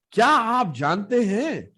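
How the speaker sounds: आप lifted high and slow, then falling; slow high question leaning on आप; high stress on आप. Shown as a demonstration. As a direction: up high on आप, drawn out. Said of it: "There is an intonation change".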